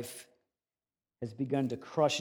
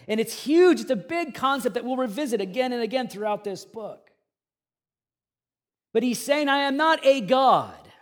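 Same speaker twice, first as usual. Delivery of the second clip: preaching at full force; 9 semitones higher, 11 dB louder than usual. Acted. Pastor declaring God's plan to the congregation, loud preaching. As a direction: proclaim it to the whole room.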